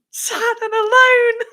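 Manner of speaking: high, slow wail of mock despair; hammed-up tragic lament; mock self-pity. Self-pity punchline about herself.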